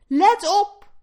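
high, loud warning cry; calling out. A narrator's reading. Oldest piglet warns his brothers, calling out. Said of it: "riep het oudste biggetje".

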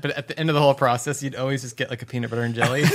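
ordinary talk

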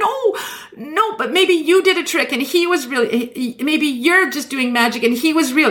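imitating Barbara Walters clinging to her belief, in a high voice; mock pleading denial. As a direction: beg to keep believing. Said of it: "she's just so disappointed".

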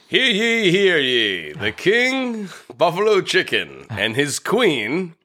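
high, slow royal proclamation; town crier. Pretend. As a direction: announce it like a herald. Mock royal proclamation of pizzas.

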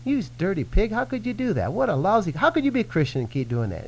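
high, scornful accuser's voice; mock accuser. Accuser condemns the sinner, in a high scornful voice. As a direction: sneer it like the accuser. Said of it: "here comes the condemnation".

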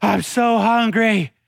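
drawn-out whiny child imitation; whiny kids. Kids pestering their parent for food and drink, whining. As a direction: whine it slowly like a needy kid. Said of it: "My kids are".

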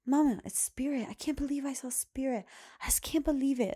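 put-on high, amazed little-kid voice; imitating excited daughter. Daughter tells mom she saw Spirit, mimicked high and thrilled.

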